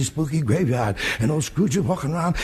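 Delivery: usual speaking voice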